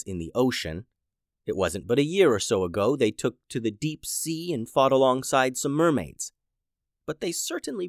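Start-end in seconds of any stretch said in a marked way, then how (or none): none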